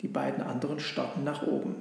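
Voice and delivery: plain reading voice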